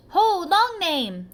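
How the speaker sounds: put-on children calling loudly, slow and drawn out. A narrator's reading. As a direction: call across the playground, teasing. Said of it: "they'd call".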